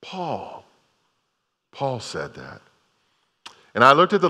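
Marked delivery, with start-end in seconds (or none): 0.0-2.6 hushed emphasis. Real, not acted. Preacher drops to emphatic hush.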